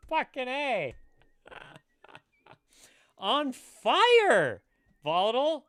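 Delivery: high, loud hyped shouting; excited cheer. Real, not acted. Streamer cheers a generous viewer, loud and excited.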